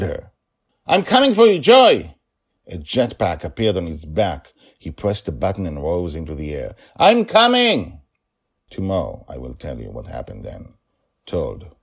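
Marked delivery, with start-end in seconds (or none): 0.9-2.1 high loud shout; determined rescuing shout; calling back. Acted. Father answers daughter's cry. 7.0-8.0 high, loud, slow shout; determined shout. Acted. Father flies to daughter.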